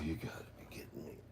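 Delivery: whispered disbelief; exasperated whisper. Real, not acted. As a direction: hiss it out as the phone dies again. Phone cuts off again.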